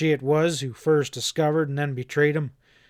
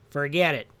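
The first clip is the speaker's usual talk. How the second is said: excited interruption. Acted. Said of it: "Epp interrupted excitedly".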